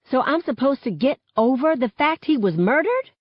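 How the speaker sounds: high, rising question in disbelief; incredulous challenge. A narrator's reading. Girl confronting friend over a murdered friend, incredulous. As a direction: challenge her in disbelief, pitch climbing. Acted quarrel, incredulous comeback.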